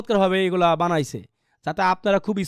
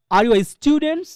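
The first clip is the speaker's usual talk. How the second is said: "students" drawn out and higher after a quick "are you a"; stress on STUDENTS; 6 semitones higher, much slower than usual. Shown as a demonstration. Teacher illustrates sentence stress, "students" pressed long. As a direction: rush "are you a", then lengthen "students". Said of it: "জোরে বললাম এবং লম্বা করে বললাম".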